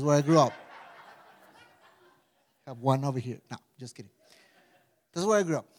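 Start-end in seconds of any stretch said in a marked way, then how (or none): none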